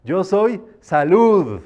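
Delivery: loud, slow "Yo soy salud" like raising a glass; toast-style decree. Shown as a demonstration. Teacher demonstrating a decree shouted like a toast, loud and slow. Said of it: "cómo se dice la salud cuando brindas".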